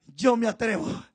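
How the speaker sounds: "Yo me atrevo" low and soft, almost to himself; quiet resolve. Acted. Moses quietly offering himself to God, low and soft. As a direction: murmur "Yo me atrevo" with calm resolve. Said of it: "Entonces Moisés dijo".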